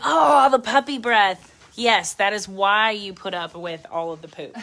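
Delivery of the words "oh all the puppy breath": gushing puppy lover. Retold or imitated. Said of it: "people are like".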